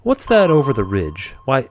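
ordinary talk, nothing marked

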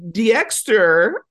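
high, loud call of Dexter's name; a mom calling her son across the house; Midwest mom calling. Retold or imitated. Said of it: "His mom is very, like, Midwest".

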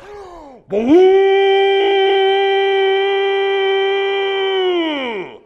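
long, high, loud trumpet blast by voice; imitated shofar blast. Pastor imitating the trumpet of God, loud sustained blast. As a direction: blare it like a war horn. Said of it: "they sound like Norse war trumpets".

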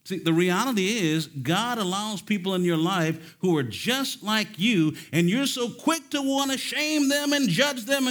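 loud, high, driving sermon delivery; rising pulpit fervor; full-force preaching. Acted. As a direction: preach it hard to the saints.

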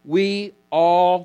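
"all" drawn out with emphasis; stress on all. Shown as a demonstration. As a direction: land firmly on "all" and hold it. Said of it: "notice the emphasis on all".

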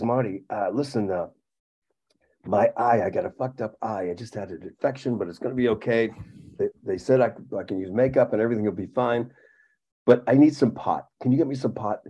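quiet, low voice asking a favour; hushed imitation of a friend's plea; low-key request. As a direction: keep it soft and confidential, slightly low. Sick friend privately asks a favour.

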